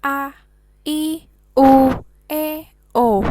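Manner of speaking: loud, evenly spaced A, I, U, E, O with plain Indonesian vowels; Indonesian vowel names. Shown as a demonstration. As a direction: recite "A, I, U, E, O" as ah, ee, oo, eh, oh. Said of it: "kita kan bacanya biasa ya".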